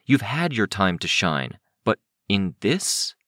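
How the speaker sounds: plain reading voice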